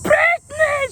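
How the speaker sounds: high, loud scream of her name; imitating screamed name. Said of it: "She's just screaming at me".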